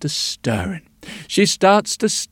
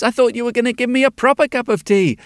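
fast, higher complaint; indignant old woman; 7 semitones higher, 3 dB louder, faster than usual. A narrator's reading. Old woman complains to the witch, fast and peeved. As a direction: protest the odd tea. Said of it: "she says".

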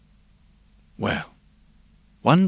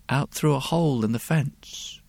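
plain reading voice

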